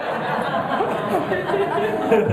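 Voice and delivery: a burst of high laughter at his own joke. Real, not acted. Preacher laughing at his own bacon slip, high laugh.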